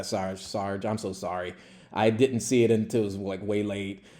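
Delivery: low, soft confession; sheepish apology. Retold or imitated. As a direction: own up quietly to the sergeant. Acts own apology to the sergeant.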